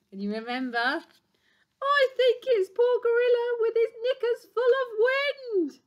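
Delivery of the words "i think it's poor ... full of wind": very high, loud, squeaky exclaiming; excited hyena. Acted. Hyena spots poor gorilla in the sky, excited squeal. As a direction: squeal the discovery at full pitch.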